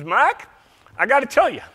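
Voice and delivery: folksy Southern accent, hammed up. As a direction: call out in a put-on Southern accent. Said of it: "he was talking in a Southern accent".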